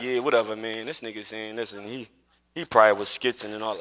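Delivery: low, muttered mock brush-off; dismissive doubter. Imitating a skeptic in chat, low and dismissive.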